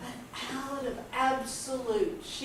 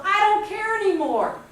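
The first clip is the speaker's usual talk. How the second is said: loud, higher-pitched outburst; defiant shout; 8 semitones higher, 8 dB louder than usual. Retold or imitated. Teenager declaring herself to the world, shouting defiantly. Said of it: "shouted to the world".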